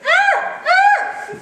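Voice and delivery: very high, loud bared-teeth scream; threatening chimp scream. Shown as a demonstration. Lecturer baring teeth in a chimp threat call. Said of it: "When they're doing a pant hoot like this".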